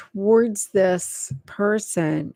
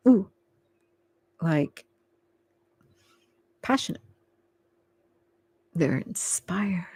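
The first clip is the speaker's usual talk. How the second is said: whispered; 3 semitones lower, 5 dB quieter than usual. Real, not acted. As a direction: let it fall to a whisper. Soft reading of feelings.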